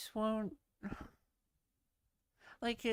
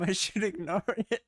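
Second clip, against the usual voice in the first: laughing through words; 3 semitones lower, 6 dB louder, faster than usual. Real, not acted. Laughing while talking.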